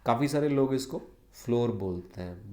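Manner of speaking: ordinary talk, nothing marked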